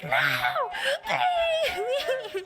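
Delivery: very high, hammed-up plea; mock pleading. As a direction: beg in a squeaky put-on voice. Acted plea in a retold exchange.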